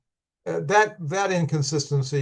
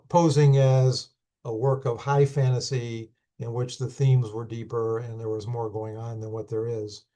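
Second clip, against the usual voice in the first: usual speaking voice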